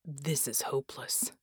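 very soft, whispered inner thought; hopeless whisper. Acted. Red privately doubts their chances against Baron, hopeless whisper. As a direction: whisper it low, in despair.

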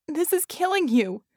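high, loud, distressed protest; pained, high-pitched plea; anguished moan. A narrator's reading. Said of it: "she moaned".

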